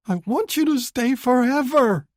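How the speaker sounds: put-on high, loud tearful wail of a young woman. Woman begging her lover to stay, wailing loudly. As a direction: cry it out, pleading. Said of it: "Tessa wails".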